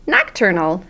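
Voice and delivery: ordinary delivery, nothing marked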